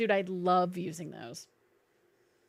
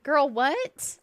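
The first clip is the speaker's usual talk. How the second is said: high incredulous what; surprised; 5 semitones higher, 3 dB louder than usual. Real, not acted. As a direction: react in disbelief. Zero income surprise.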